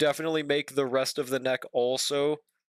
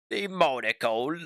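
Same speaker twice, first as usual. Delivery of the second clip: slow, put-on British accent; mock British accent; 3 semitones lower, slower than usual. Playing British after monocle joke.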